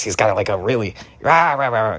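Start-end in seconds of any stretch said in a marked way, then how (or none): 1.2-2.0 Reno impression. Shown as a demonstration. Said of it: "he sounds kinda like this".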